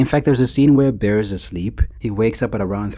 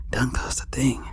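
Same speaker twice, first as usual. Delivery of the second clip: hushed echo of the song line; whispered; 8 dB quieter than usual. Shown as a demonstration. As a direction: breathe "thing" out as a soft whisper. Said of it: "And my love don't cost a thing".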